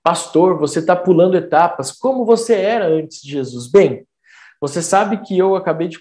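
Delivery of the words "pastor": ordinary talk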